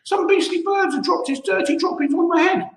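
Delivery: put-on high, disgusted old-woman voice; a hint of a shrill hag complaining; cross old woman. A narrator's reading. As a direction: Missus Twit grumbling about the bird droppings. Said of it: "cried Missus Twit".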